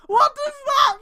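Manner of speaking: high, loud cry of disbelief; outraged shout. Real, not acted. Streamer yelling at the fanfic author, outraged shout. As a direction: demand an answer, yelling. Reacting to absurd line.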